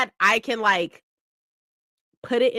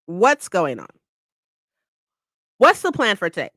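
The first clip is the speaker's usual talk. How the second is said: ordinary delivery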